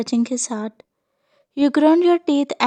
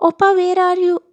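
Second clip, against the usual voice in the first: calling out; 6 semitones higher, faster than usual. A narrator's reading. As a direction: call out after someone leaving. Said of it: "she called out".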